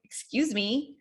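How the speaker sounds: pointed, indignant "excuse me"; rude tone. Shown as a demonstration. Coach showing the wrong tone to interrupt with, rude. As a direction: say it with attitude, as if affronted. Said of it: "it's also your tone, the way that you say".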